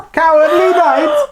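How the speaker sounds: high, drawn-out mock Italian; badly faked Italian, slow and high; Italian accent. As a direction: stretch it out like a comic Italian.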